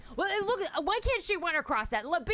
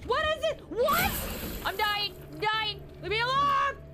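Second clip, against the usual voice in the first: terrified cries; 5 semitones higher than usual. Real, not acted. Streamer mauled by a monster, high terrified shouts. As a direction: beg the monster to stop.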